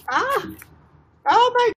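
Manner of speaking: high, startled cry; alarmed surprise. Real, not acted. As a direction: react to the dog eating something. Dog has something in mouth.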